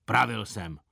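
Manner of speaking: usual speaking voice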